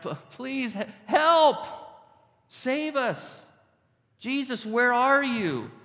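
high-pitched plea for rescue; desperate crying out. Acted. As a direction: beg as if about to go under. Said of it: "Maybe they were crying out".

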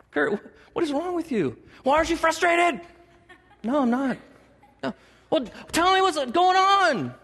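high-pitched, agitated back-and-forth; mock exasperation. Preacher imagining a frustrated exchange as God looks down, mock exasperation. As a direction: demand answers in a high, hammed-up fluster.

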